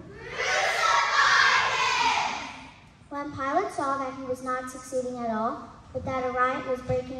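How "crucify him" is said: high, slow shout, rising on crucify; louder shout. Retold or imitated. The crowd shouting louder at Pilate, a rising shout. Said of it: "They only shouted the louder".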